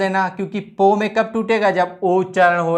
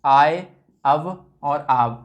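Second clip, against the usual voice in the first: 1 slow listing; much slower than usual. Shown as a demonstration. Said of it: "चार ध्वनियाँ जो हमारी हैं कौन सी".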